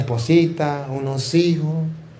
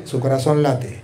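soft hush; 6 semitones lower, 9 dB quieter than usual. Shown as a demonstration. Quiet aside about the heart.

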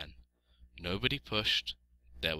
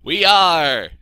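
high, very loud bleat; agreeing flock; 10 semitones higher, 17 dB louder than usual. A narrator's reading. Voices the flock.

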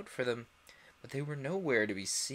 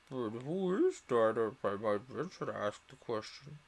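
stiff Lory; 2 semitones lower than usual. Acted. The Lory asking Alice, lightly voiced. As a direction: ask with prim politeness. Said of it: "said the Lory".

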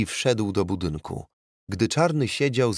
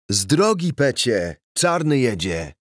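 głośno i dużo wyżej, radośnie; radosny krzyk; 4 semitones higher, 4 dB louder than usual. A narrator's reading. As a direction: wykrzycz to z frajdą zza kierownicy. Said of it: "krzyknął radośnie policjant". (policjant radośnie krzyczy na młodego z samochodu)

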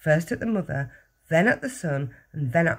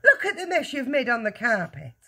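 raised-pitch scolding shout; mild shout; 7 semitones higher than usual. A narrator's reading. Husband scolds wife over the mess, shouting. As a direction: scold in a higher, mildly shouting voice. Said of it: "he shouted".

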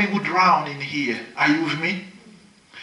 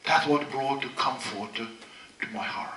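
slow whisper; whispered comfort; 5 semitones lower, 10 dB quieter, slower than usual. Real, not acted. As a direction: breathe it out slowly to the congregation. Whispered repetition.

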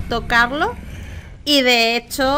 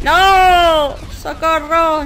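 sudden high yell of no, then help; panicked shout; 5 semitones higher, 6 dB louder, much slower than usual. Real, not acted. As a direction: cry out as you get caught. Character being killed.

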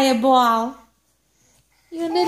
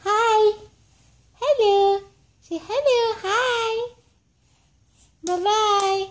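usual speaking voice

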